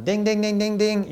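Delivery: high, ringing ding-ding-ding; imitated alarm bell. Preacher flags that something is off, imitating an alarm bell. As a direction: sound a mock alert bell.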